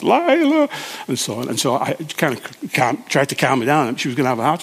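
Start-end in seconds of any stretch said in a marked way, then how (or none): 0.0-0.7 high, loud call of a dog's name. Retold or imitated. Frantic owner calling her lost dog up the hill, loud high call. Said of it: "She's shouting to the top of her voice".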